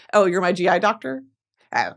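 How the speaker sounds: usual speaking voice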